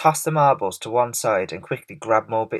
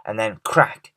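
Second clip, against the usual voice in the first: plain reading voice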